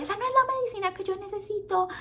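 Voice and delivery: put-on high, whiny little girl voice; defiant child. Girl refusing her medicine, high and whiny. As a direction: play the stubborn kid refusing medicine. Nanny retells girl's tantrum.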